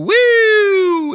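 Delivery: loud, high whoop; sudden high celebratory "Woo!"; mock party whoop. Cheers going back into a K-hole.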